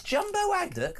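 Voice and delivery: high-pitched surprised question; incredulous query. Real, not acted. Host querying a listed chip-shop order, incredulous. Querying a price list item.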